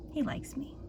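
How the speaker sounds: soft playful whisper. Real, not acted. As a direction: whisper it teasingly aside. Teasing aside to camera.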